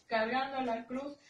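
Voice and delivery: plain reading voice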